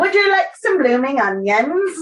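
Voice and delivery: put-on cheesy Aussie accent; Australian accent. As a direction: ham up a tourist Australian. Mock Aussie line, timbre shifts.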